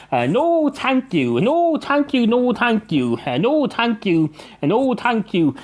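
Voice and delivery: high-pitched, repeated sarcastic refusals. Acted. Cat turning down the barman's Fanta, sarcastic. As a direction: lift the first word high, mock-polite. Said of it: "in a rather sarcastic tone".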